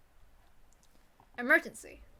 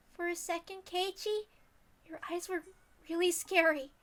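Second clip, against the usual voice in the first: put-on much higher, gentle worried girl voice; worried girl Rena; 8 semitones higher than usual. Rena checking on Keiichi after he collapsed, worried.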